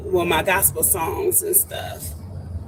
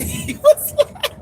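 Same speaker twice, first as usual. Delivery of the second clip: laughing. Real, not acted. Laughing while retelling.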